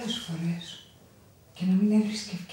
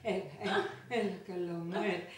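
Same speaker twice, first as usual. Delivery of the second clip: soft, quick hushing; soft tender hush; gentle hush; 3 semitones lower, 6 dB quieter, faster than usual. Acted. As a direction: settle the baby softly. Hushing the child.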